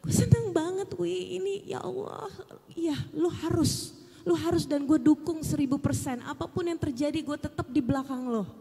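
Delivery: high, excited voice of the crying manager; tearful happy friend. Retold or imitated. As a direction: squeal it like an overjoyed friend in tears. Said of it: "Dia nangis dan cuma bilang". (suara tinggi haru dan gembira)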